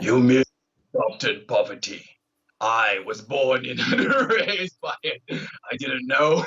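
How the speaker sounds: masked villain, low and slow; muffled respirator-style villain voice; Bane. Pretend. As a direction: intone it slowly, breaking into amusement.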